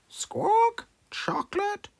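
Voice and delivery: very high whispered parrot echo; whispered parrot squawk. A narrator's reading. Polly copying Susie Sheep, whispered very high squawk. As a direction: squawk the word back in a whisper. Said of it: "Polly Parrot copied".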